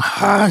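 high, drawn-out mock scream; retold scream. Venting in the car after shift, high retold scream. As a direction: let out a re-enacted yell of frustration. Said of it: "I'm screaming".

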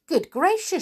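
high, startled exclamation; surprised gasp. Acted. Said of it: "she said".

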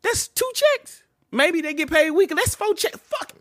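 very high, slow, disbelieving; incredulous squeal. Real, not acted. Counting lost paychecks with his cohost, incredulous squeal. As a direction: let the pitch fly up in disbelief.

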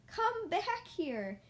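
high, very drawn-out call; teacher calling out in mild amusement; amused calling. A narrator's reading. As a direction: call after a runaway child, playfully. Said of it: "Miss Edwards said, laughing".

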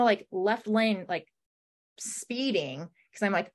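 ordinary delivery

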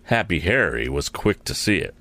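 plain reading voice

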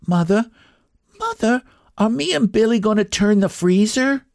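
put-on high, eager child calling mother; a small boy calling out, very high; child's voice. A narrator's reading. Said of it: "said a child's voice".

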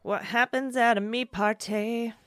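slow, high, teasing sing-song; playful. Pretend. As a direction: draw it out like a secret club motto. Playful me-party bit.

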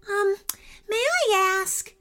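put-on high, gentle, polite little girl. A narrator's reading. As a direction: ask ever so politely. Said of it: "said Ananya politely".

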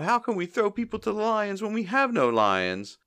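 very high-pitched whiny wail; mock crying. Mo crying about having no lions, mock wail. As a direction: imitate a tearful, hammed-up lament. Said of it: "Mo starts to cry about".

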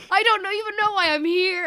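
high, loud mock wail; hammed-up helpless panic; flustered protest. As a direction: play it comically out of your depth. Acting out his flustered panic.

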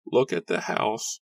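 high, slow, frail cry; frail woman crying out. Acted. Frail woman points villagers to the house, high cry. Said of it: "a frail woman a few feet from Nora cried".

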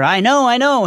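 high, loud triumphant cry; triumphant scream. A narrator's reading. Harry finally places the corridor, loud triumphant cry. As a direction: burst out with the discovery. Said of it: "Harry screams in triumph".

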